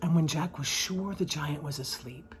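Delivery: ordinary delivery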